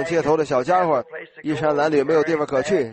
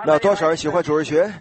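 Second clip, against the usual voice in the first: ordinary talk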